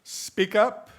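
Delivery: projected to the back of the room; reading out an instruction. Acted. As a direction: read it out high and loud like an order. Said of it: "instructions from my wife here".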